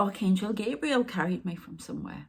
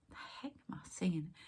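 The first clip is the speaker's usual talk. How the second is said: softer, half-whispered puzzled thought; a hushed aside to herself; whispered inner thought; 10 dB quieter than usual. Retold or imitated. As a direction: murmur it to yourself, bemused. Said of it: "and I think".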